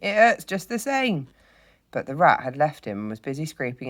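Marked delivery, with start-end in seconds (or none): 0.0-1.3 high, loud, slow wail; pained. A narrator's reading. Hurt mole complaining to rat, pained. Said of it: "forgetting his grammar in his pain".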